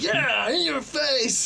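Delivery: high, drawn-out mock gloat; celebrating. Friend gloating in front of everyone, imitated celebration. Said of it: "He celebrated in front of people".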